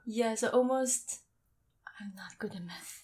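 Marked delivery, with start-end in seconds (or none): 2.0-3.0 whispering. Real, not acted. Embarrassed aside about counting.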